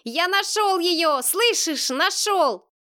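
put-on high, drawn-out boy's call at moderate volume; crying out. A narrator's reading. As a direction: call the news across a noisy crowd. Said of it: "это крик".

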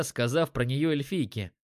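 ordinary delivery

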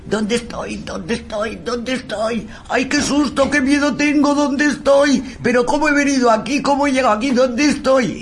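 put-on high, scared Spanish with an English accent; British accent in Spanish. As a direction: play a frightened Englishman lost and asking where he is. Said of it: "con un acento british".

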